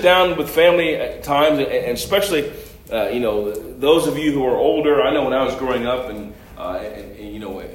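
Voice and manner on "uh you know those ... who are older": ordinary talk, nothing marked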